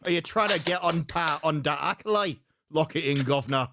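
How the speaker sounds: confident Cockney demonstration; Cockney London accent. Shown as a demonstration. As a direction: do proper London Cockney for them. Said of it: "it's basically the thud accent".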